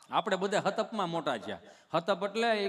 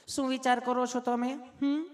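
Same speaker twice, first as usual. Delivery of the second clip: high put-on nurse voice; sweetly asking nurse; 5 semitones higher than usual. Nurse asking why he is nervous, high mock sweetness. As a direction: coo questions up high at the patient. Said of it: "તમે કેટલું પ્રેમથી પૂછો છો".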